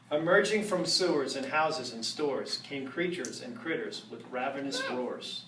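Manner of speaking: rhythmic rhyming read-aloud, drawn out; storybook reading. Acted. Reading a Halloween rhyme aloud to the audience, spooky storytelling. Reads Halloween book to crowd.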